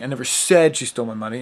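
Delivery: "said" punched loud, the rest fast; stress on SAID. Shown as a demonstration. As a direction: hit the word "said" hard. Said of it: "seven different ways".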